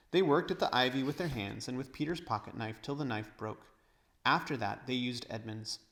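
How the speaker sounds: ordinary delivery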